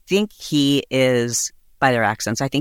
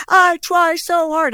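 slow, high, louder wail; mock wailing scream; 4 dB louder, slower than usual. Girlfriend screaming at her boyfriend: slow, high mock wail. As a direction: stretch it into a whiny howl. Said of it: "screaming at the top of her lungs".

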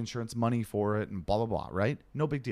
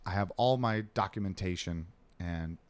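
ordinary talk

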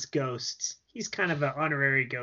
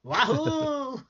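high cartoon wahoo; wahoo yell; 11 semitones higher than usual. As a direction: shout it like Mario. Mario catchphrase yell.

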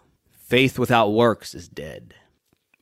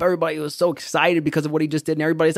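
ordinary talk, nothing marked

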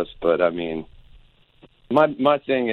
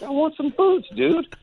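mock whiny demand. Insisting on boots, voiced high.

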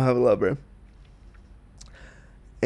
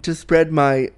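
ordinary talk, nothing marked

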